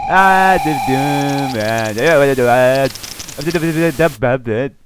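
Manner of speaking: vocal drum roll; mock drum roll. Imitating the game's dramatic effect, vocal drum roll. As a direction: rattle out a spoken drum roll.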